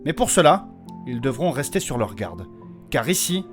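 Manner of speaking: ordinary talk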